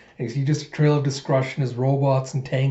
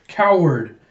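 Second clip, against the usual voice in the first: raised, loud accusation; accusing; 4 semitones higher, 5 dB louder than usual. Acted. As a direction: hurl the insult out. A voice hurls an insult.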